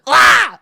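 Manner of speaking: high loud shout of a crushed goblin; shrill crushed-creature yelp; goblin death scream. Acted. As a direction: scream as the club lands. Goblin crushed under club.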